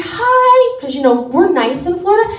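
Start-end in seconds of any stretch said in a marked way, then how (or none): 0.0-0.8 very high, bright-voiced greeting; chirpy Floridian hi. Nice Floridian greeting the bakery clerk, very high. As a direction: sing out a friendly hi. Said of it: "walk into the bakery".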